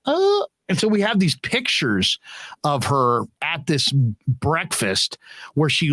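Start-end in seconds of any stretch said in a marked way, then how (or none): 0.1-0.5 high little "oh"; imitated scared gasp. Young daughter shrinking from characters, high mock gasp. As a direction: mimic a frightened small child's intake. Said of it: "she's kinda like".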